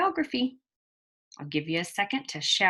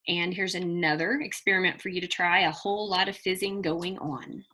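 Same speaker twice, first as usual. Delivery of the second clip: ordinary delivery, nothing marked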